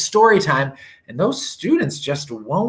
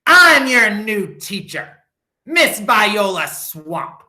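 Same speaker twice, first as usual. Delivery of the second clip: stern substitute; 7 semitones higher, 4 dB louder, slower than usual. A narrator's reading. Stern substitute introduces herself.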